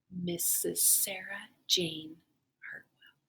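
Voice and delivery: slow, soft, hushed reveal of the twist. A narrator's reading. Teacher revealing the story's twist to her class, soft and slow. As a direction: drop the voice for the surprise. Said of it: "reveal of twist".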